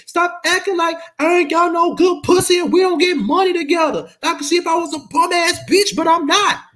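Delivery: high, loud whiny rant; hammed-up screeching girlfriend; imitating her. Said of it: "and like".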